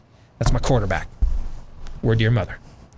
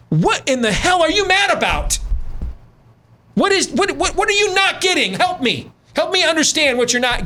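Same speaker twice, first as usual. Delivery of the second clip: exasperated disbelief; 11 semitones higher than usual. Real, not acted. Host baffled at caller's anger.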